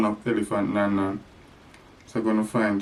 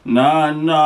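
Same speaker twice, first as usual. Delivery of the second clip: cow calling; 4 semitones higher, 5 dB louder than usual. Pretend. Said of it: "The cow calling Nan Nan".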